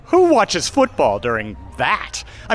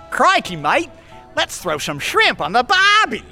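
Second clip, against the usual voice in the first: hammed-up high Australian accent; bad put-on Australian accent; 9 semitones higher than usual. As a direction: play a cartoon Aussie, going way up in pitch. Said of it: "I could be Australian".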